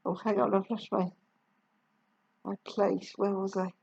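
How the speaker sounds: soft, low muttered aside. Real, not acted. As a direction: drop out of the story quietly. Reader loses place aside.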